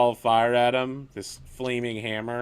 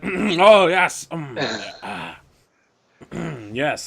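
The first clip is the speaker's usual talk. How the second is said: loud, high, flustered hunter; flustered NPC; 4 semitones higher, 8 dB louder, much slower than usual. Acted. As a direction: caught out, bluster to cover. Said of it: "He's like".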